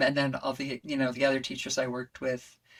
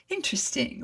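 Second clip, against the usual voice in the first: high-pitched imitated British politeness; British accent; 12 semitones higher than usual. Student imitating the teacher's reply to a cracked note, high British accent. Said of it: "with his British accent".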